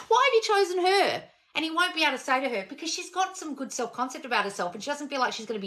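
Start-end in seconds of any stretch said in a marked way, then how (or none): 0.1-1.2 high, whiny mock plea; a hint of a jilted girl pleading. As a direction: play the hurt girl lightly. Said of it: "you go back to him".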